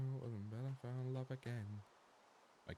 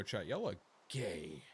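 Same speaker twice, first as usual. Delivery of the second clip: ordinary talk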